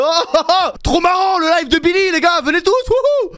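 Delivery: high, loud mocking hype voice; obnoxious chat intruders. Mimicking random guys flooding his chat, mock hype. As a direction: imitate overexcited trolls, laughing and whooping. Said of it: "il y a des mecs qui arrivent au hasard dans mon chat".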